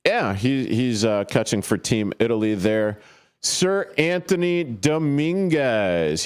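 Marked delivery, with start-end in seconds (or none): 3.4-6.2 grand announcer. Acted. Grand title for a player.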